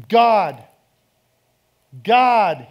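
loud anguished call to God; crying out to God. Acted. Tax collector beating his chest, crying out loud to God. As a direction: cry out God's name like a broken man. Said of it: "beating his chest saying".

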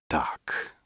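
put-on a drunk whispered yes. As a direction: breathe the word without voice.

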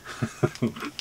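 soft, real chuckle; quiet laugh that slips out; helpless chuckle. Real, not acted. Reseller tickled by an old cigarette ad, soft chuckling. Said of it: "I can't stop laughing".

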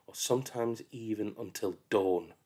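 plain reading voice

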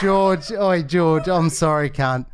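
desperate call to George on the phone. Acted. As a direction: shout over to George. Prank call, calling out to George.

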